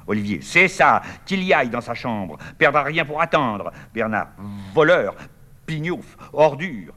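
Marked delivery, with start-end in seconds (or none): 0.4-3.7 loud, high threatening jeer; angry jeer. Acted. Brother threatening his rival. 4.7-6.9 loud, high spat-out insults; hurled insults. Acted. Bernard hurling insults.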